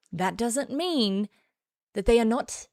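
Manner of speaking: ordinary talk, nothing marked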